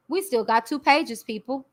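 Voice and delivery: normal talking voice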